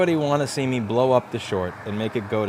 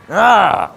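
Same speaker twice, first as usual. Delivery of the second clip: loud startled yelp; 9 semitones higher, 11 dB louder than usual. Real, not acted. Sudden loud outcry on stream.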